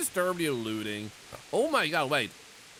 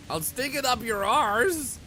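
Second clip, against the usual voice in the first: mock taunt; 8 semitones higher, 5 dB louder, slower than usual. Taunting a game character.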